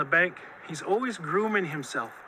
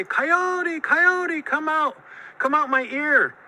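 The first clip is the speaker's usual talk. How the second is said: high, loud pleading calls; begging; 8 semitones higher, 4 dB louder, slower than usual. A narrator's reading. Monster begs Coyote to come out, high pleading. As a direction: beg in a raised, drawn-out holler. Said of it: "began to beg Coyote to come out".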